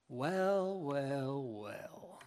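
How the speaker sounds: drawn-out, gloating well well well, trailing off soft; mock-smug brother savouring his triumph. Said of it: "I would probably say".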